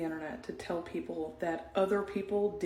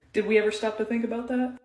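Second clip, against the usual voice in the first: usual speaking voice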